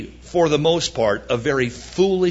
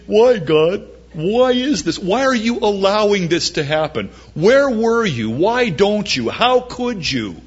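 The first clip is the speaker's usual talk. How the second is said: whiny complaint; 5 semitones higher than usual. Retold or imitated. People whining at God.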